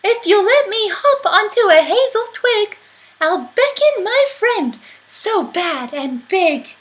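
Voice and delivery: high, loud clever mouse; squeaky mouse. Acted. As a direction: squeak a crafty offer.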